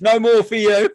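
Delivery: high, loud mock cry; mock outburst. Teasing a friend who jumps in, high mock outburst. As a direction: blurt it out high and loud, imitating him. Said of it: "He just jumps in and goes".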